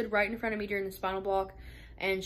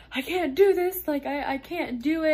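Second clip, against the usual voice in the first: much higher and louder, reliving the panic; panicked cry; 7 semitones higher, 6 dB louder than usual. Retold or imitated. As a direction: blurt it out like the fear is back. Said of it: "I panicked".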